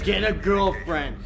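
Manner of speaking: loud slow mock complaint; loud mock retelling. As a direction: drag it out loud, ribbing your friend. Mocking a friend's old advice.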